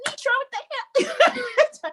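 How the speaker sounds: high, drawn-out exasperated whine; exasperated high-pitched complaint; mock frustration. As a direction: squeal it in playful frustration at your coach. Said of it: "I'm getting frustrated now".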